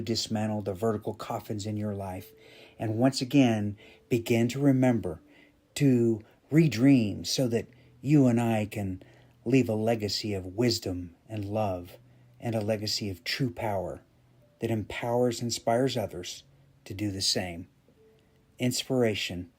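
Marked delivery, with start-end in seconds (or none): none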